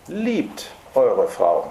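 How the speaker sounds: „Liebt“ punched, whole phrase very slow; stress on Liebt. Shown as a demonstration. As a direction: hit „Liebt“ hard and drag the rest. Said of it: "Die erste Betonung ist". (Betonung auf „Liebt“, sehr langsam)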